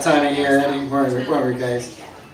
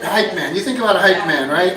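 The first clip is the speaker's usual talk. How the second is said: usual speaking voice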